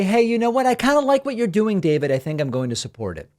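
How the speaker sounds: lightly voiced fan, bright and quick; cheerful viewer voice. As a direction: play an eager supporter chiming in. Voicing a supportive viewer.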